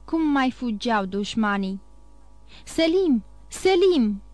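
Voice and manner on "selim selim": high calling cry, each Selim falling; shouting a name. Acted. Pasha calling to the guard of the fire, loud shout. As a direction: call Selim across the din, louder the second time. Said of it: "strigă el".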